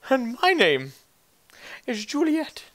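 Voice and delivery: high falsetto duchess; very high, put-on woman's voice; prissy village woman. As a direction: introduce yourself as a fancy lady. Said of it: "the woman says".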